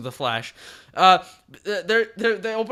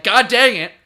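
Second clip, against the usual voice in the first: loud, higher comic outburst; mock exasperation; 5 semitones higher, 11 dB louder than usual. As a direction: curse in hammed-up frustration. Retold hero thwarted yet again.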